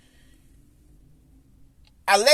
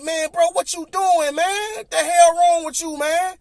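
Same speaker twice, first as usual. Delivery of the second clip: upset disbelief; 8 semitones higher, 3 dB louder than usual. Retold or imitated. Upset after jersey stomped.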